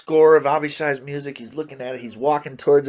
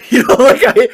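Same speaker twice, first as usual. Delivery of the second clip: laughing; 11 semitones higher, 13 dB louder than usual. Real, not acted. Laughing at his own story.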